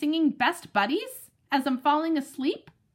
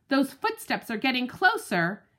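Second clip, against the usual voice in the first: ordinary delivery, nothing marked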